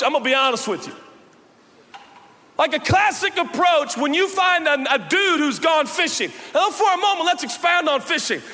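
high, loud, shouting sermon delivery; fired-up pulpit preaching; full-force preaching. Acted. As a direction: preach it out to the whole room at full power.

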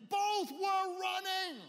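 big high shout, drawn out; shouted climax. Acted. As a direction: shout it like the winning moment. Climax of race story.

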